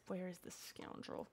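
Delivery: normal talking voice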